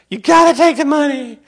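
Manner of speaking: high urgent plea; desperate high insistence. Retold or imitated. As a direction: push hard, almost panicked.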